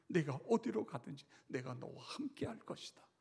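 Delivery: normal talking voice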